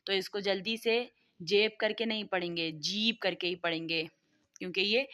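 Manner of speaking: ordinary talk, nothing marked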